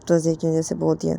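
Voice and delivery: ordinary delivery, nothing marked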